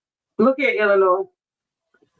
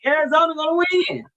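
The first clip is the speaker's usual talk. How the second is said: high, quick call for the back row; raised-pitch shout-out, only mildly forceful; 8 semitones higher, faster than usual. Real, not acted. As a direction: pitch it up high, proclaiming it to the crowd. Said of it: "I say it louder for the people".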